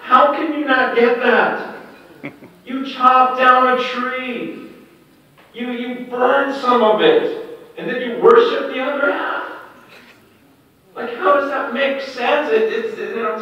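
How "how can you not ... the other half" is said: exasperated shouting rebuke; God rebuking. Retold or imitated. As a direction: hammer home how absurd it is. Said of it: "God says".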